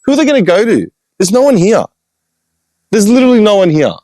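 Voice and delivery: high, mocking put-on voice; exasperated high-pitched retort. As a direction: scoff at them in a high squeaky voice. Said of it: "I'm like".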